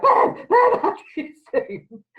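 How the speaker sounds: high, laughing mock panic; laughing imitation of a Zoom meltdown. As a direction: play the flustered newbie, laughing. Retold panicked meltdown quote.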